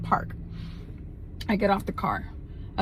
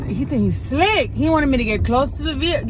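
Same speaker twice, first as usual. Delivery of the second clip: higher-pitched, worked-up disbelief; indignant realisation; 5 semitones higher than usual. Real, not acted. Woman realises a stranger tried luring her, indignant. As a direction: call out his scheme, pitch lifted.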